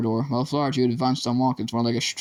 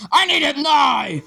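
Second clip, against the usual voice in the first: much higher, louder, slower roar; furious shout; 6 dB louder, slower than usual. A narrator's reading. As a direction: bellow it, losing control. Teacher banging pupil's head.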